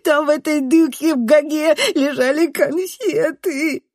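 high, slow, loud squealed denial; squealing whiny boy. A narrator's reading. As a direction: squeal it like a cornered child, can't say R. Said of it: "визжит он". (визжит, картавя и оправдываясь)

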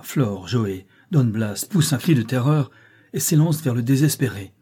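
ordinary delivery, nothing marked

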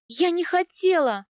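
high, loud, desperate protest; crying out. A narrator's reading. Wife excusing herself to her husband, crying out. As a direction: cry it out pleadingly. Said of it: "выкрикнула я".

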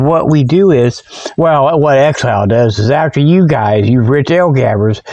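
ordinary delivery

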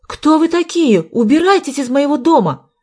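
high, loud outcry; indignant shouting. A narrator's reading. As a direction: shout the intruders out of the house. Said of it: "закричала Марина". (высокий возмущённый крик)

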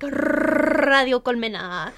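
"Radio Colmena" langzaam, met een sterk gerolde r in "Radio"; rolled R's. Shown as a demonstration. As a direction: rol de r van "Radio" lang en zet "Colmena" uitgerekt neer. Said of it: "they kind of roll their R's a lot".

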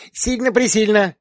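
put-on very high, eager child's voice; a tiny one backing up her sister; little girl insisting. A narrator's reading. Younger daughter eagerly agrees with her sister. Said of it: "подтверждала младшенькая".

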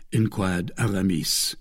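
plain reading voice

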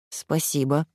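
a grudging "Спасибо" squeezed out through the teeth; through gritted teeth. A narrator's reading. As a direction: spit the thanks out through clenched teeth. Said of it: "процедила она сквозь зубы".

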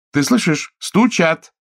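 high, urgent woman; Chiara alarmed. A narrator's reading. Chiara warns Giuseppe of the knock, high.

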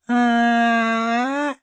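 долгий очень высокий приглушённый визг; визжи протяжно, как капризная девочка. A narrator's reading. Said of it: "завизжала баронесочка". (тонкий протяжный детский визг)

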